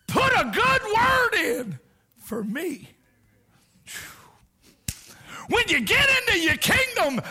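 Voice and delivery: high pleading shout, slow and stretched; pleading thief. Acted. Dying thief begging Jesus to remember him, high pleading shout. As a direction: beg like a dying man. Thief's plea acted with fervor.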